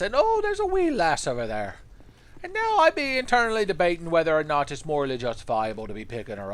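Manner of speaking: put-on over-the-top Irish accent, loud and high at first, then trailing off. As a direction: play a cartoonish Irish adventurer in an Irish accent. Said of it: "your stereotypical Irish voice".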